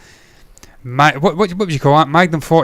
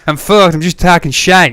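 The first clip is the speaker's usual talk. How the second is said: put-on American accent; 3 semitones higher, 6 dB louder than usual. Host giving up after being teased, loud mock American accent. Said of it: "You can't say that in an American accent".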